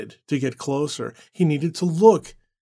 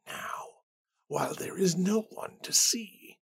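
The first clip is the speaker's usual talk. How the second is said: eerie whisper; 4 semitones higher, 9 dB quieter than usual. Acted. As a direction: breathe it like a voice in his head.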